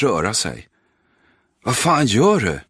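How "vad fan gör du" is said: high, loud frightened yell at a bully; boy screaming at an older kid. Acted. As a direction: shout it out, desperate to stop him. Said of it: "Leo skriker".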